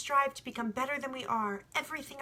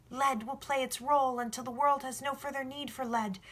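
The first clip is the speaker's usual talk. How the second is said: put-on higher, eager young shepherd explaining; young boy, earnest; 5 semitones higher than usual. The boy tells the sun about love, earnest and higher. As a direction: press the point as a sincere boy.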